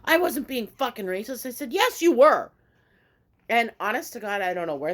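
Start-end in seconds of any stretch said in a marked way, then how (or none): 0.0-1.5 half-voiced mock scream; retold screaming. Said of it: "he starts screaming at me". 1.7-2.5 loud, high-pitched retort; re-enacted yell, pitched up; retold shout back.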